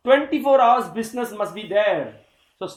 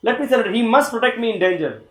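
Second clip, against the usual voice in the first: a touch higher, firm and sure of himself; confident devotee; 4 semitones higher, 6 dB louder than usual. Retold or imitated. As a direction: say it like a man certain of protection. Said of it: "You see how confident he was".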